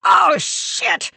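high, loud panicked yell. Acted. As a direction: bellow it out in pain. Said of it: "He yelled".

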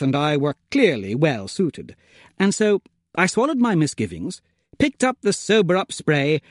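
ordinary delivery, nothing marked

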